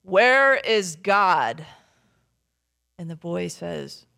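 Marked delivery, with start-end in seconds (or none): 0.0-1.7 loud, slow pastor's boom; big booming voice. Acted. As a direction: boom it out slowly like an imposing pastor. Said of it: "in his big booming voice".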